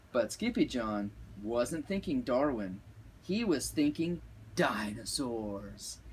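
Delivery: plain reading voice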